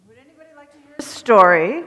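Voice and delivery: loud, quick call to the room. Real, not acted. Minister calling children to story time, loud and quick.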